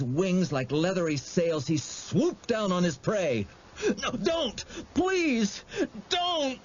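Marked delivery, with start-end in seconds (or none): 4.0-6.6 high, sobbing cries of no; sobbing plea. A narrator's reading. Boy sobbing as he runs from the monster, pleading. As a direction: beg through sobs, voice way up. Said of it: "Wendell was sobbing as he ran".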